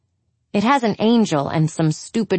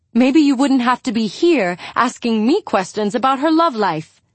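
high, raised, accusing rant; angry; 6 semitones higher than usual. Acted. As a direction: lay into her, voice raised. Angie lashing out at Julia.

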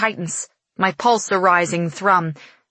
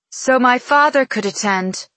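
proud and raised, a little louder; 6 semitones higher, 3 dB louder than usual. Acted. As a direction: announce it with pride. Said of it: "she explains with a proud toss".

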